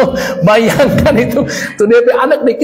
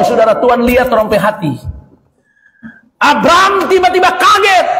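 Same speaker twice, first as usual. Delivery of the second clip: normal talking voice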